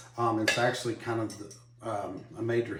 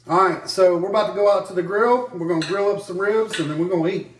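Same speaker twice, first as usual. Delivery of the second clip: vlog host intro; 5 semitones higher, 6 dB louder than usual. Acted. Host addresses viewers at grill.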